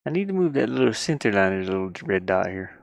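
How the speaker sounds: usual speaking voice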